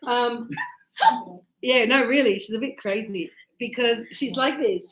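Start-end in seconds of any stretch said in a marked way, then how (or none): none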